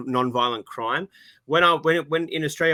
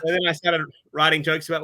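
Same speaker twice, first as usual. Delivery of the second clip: ordinary talk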